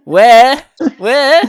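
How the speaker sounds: put-on high loud baby wail; French baby cry. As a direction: wail like a newborn. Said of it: "what a French baby would say".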